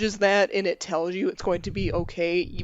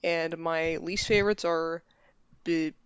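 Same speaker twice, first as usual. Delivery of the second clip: usual speaking voice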